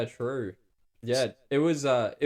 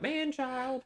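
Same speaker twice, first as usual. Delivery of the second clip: calling out; 13 semitones higher, 6 dB quieter than usual. Pretend. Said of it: "someone calling out".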